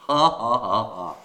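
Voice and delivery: deliberate, spaced-out fake laugh; mock laugh. Shown as a demonstration. Teacher mocks the WhatsApp pun, fake laughing. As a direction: laugh dutifully at a weak joke, falling away. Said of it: "was never funny".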